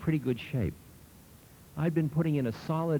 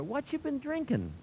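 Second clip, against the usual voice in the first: put-on much higher, lightly delighted old man; curious old man. A narrator's reading. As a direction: ask with sparkling interest. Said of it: "his eyes sparkling with interest".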